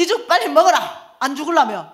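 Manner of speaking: high, loud mock threat; comically forceful scolding; fierce mother's order. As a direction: bark it like a bossy mother. Mother forcing man to eat porridge.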